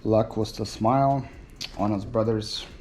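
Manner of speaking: normal talking voice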